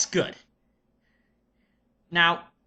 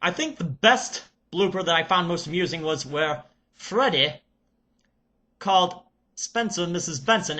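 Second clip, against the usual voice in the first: put-on Serbian accent. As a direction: play an excited Serbian reviewer character. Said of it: "I shall get into character".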